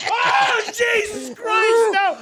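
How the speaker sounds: very high, drawn-out wail of horror; loud, hammed-up shriek; retold horrified yell. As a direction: cry out as if reliving a disgusting discovery. Retold horror at finding the toilet.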